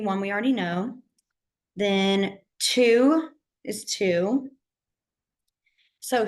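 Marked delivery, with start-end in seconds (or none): 1.7-4.5 very slow, each word spaced out; teacher drilling the number slowly. Shown as a demonstration. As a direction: spell it out slowly for the class. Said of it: "teacher drilling signs slowly".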